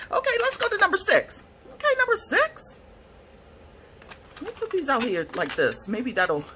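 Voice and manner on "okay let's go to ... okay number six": high, excited sing-song; giddy high voice. Real, not acted. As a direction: still giddy from the colours. Still squealing after gasp.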